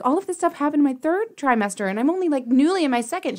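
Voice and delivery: mock-plaintive patient; complaining. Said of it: "I was, like, kind of complaining".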